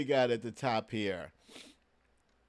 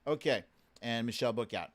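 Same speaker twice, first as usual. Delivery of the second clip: ordinary talk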